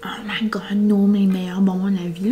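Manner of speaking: hushed aside under the breath; whispering. Real, not acted. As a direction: whisper it like a secret. Whispered aside about candy.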